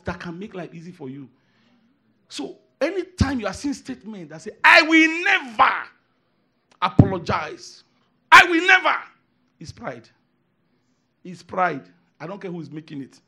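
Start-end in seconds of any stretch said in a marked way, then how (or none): 4.6-9.0 proud refusal. Retold or imitated. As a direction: belt out a stubborn never.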